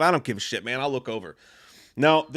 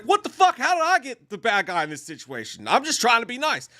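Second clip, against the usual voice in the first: high-pitched mock disbelief; protest, voice leaping up at the injustice; indignant disbelief; 7 semitones higher than usual. Man blamed while trying to be nice, high indignant disbelief.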